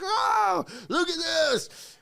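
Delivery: showing off a big catch with delight; thrilled shout of amazement; excited shout. Retold or imitated. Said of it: "They're like".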